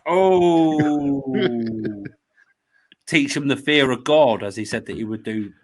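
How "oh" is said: long, loud, high "oooh"; teasing "oooh". Pretend. Co-host reacts to the guest's challenge, teasing drawn-out oooh. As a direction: draw out a loud mock-impressed oooh.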